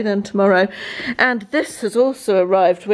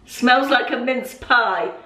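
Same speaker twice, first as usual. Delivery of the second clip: ordinary delivery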